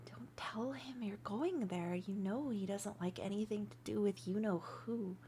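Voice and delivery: soft, breathy secret whisper. Acted. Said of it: "That's why I whispered".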